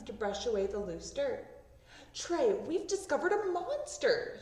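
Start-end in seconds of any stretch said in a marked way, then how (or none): 2.1-4.4 excited declaration. Acted. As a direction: announce the monster like a thrilled kid. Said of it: "she declared".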